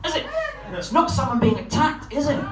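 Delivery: ordinary talk, nothing marked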